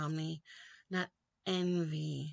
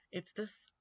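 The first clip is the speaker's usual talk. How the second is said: ordinary talk, nothing marked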